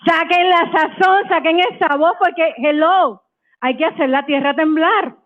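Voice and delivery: high, spirited Spanish pep call; rallying cry. Real, not acted. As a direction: shake the floor with energy. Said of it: "Aquí viene la puertorriqueña".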